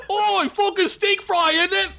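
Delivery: high silly mercenary yell; yelling elf mercenary. Acted. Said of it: "yelled a merc".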